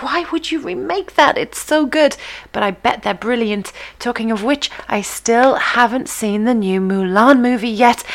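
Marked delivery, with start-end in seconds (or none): 0.0-2.2 high-pitched incredulous objection; mock protest. Host voicing her own reaction to film remakes, incredulous protest. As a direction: protest it in a higher voice, as if baffled.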